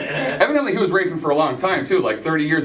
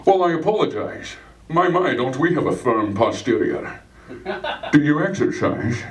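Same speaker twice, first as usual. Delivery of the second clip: Sean Connery voice; 3 semitones lower, slower than usual. Acted. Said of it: "He'd be just like".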